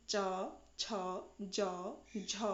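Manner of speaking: च, छ, ज, झ spoken one by one with a pause after each; sounding out palatals. Shown as a demonstration. Teacher to students, sounding out च छ ज झ one by one. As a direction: model each palatal letter slowly and separately. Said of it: "इस तरीके से बोल के देखिए".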